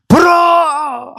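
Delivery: long, high, loud cry; drawn-out preacher's shout; shouted proclamation. Retold or imitated. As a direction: call it out loud, stretching the vowel. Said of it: "하고 소리쳤어요".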